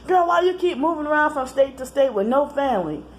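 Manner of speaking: a lighter high mocking voice; mocking brother. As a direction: lightly mimic the brother's question. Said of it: "he was on the phone".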